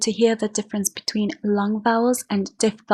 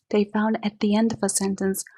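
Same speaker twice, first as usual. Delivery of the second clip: usual speaking voice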